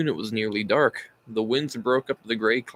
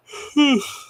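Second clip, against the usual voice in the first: loud yawn; 5 dB louder than usual. Real, not acted. Tired reader yawns.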